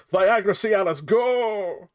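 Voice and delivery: high, loud incantation shout; spell-casting shout. Pretend. Streamer casts a silly spell for a viewer, shouting. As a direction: belt out the spell name like a wizard.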